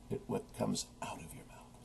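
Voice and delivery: very soft, solemn rebuke; hushed scripture quote. Retold or imitated. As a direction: drop almost to a whisper. Quoting Jesus to rebuke the listeners.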